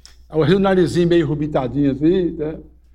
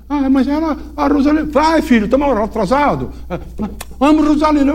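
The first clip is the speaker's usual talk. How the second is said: menino empolgado; 9 semitones higher, 3 dB louder than usual. Retold or imitated. Menino empolgado, padre apressa.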